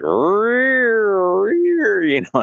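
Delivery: high, very slow laughing drawl. Retold or imitated. Describing the gimbal guy, drawls it out high while laughing. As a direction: stretch it out through a laugh.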